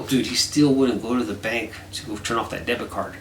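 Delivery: normal talking voice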